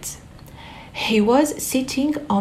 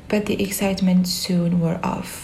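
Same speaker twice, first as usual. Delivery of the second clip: plain reading voice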